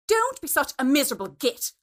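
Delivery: a loud, no-nonsense put-down; robust retort. A narrator's reading. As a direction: push back hard on the husband's grumbling. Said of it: "Alice robustly declared".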